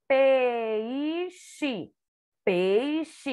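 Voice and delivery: "Peixe" ending with an I sound; final E as I. Shown as a demonstration. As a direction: say "Peixe" as peixi. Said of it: "O E no final tem som de I".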